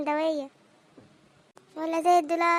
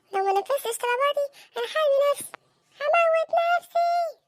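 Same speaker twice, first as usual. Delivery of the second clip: high wailing threat, building louder; child's tantrum; 8 semitones higher, 4 dB louder than usual. Acted. Child threatens father to get demands met, wailing. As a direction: stretch it into a hammed-up bratty wail.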